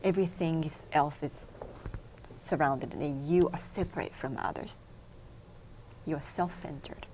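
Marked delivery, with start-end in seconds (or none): none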